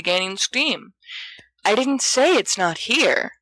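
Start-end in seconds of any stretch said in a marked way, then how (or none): 1.6-3.3 trying for patience. Acted. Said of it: "tries to say patiently".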